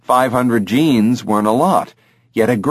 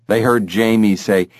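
plain reading voice

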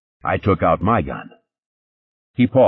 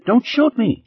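very high, terrified plea; crying out. Acted. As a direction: beg for your life in panic. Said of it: "she cried".

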